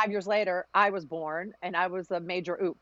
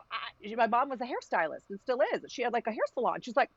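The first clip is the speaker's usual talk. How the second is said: ordinary talk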